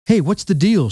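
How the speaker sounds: a contained screech of complaint; mild screech. A narrator's reading. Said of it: "she screeched".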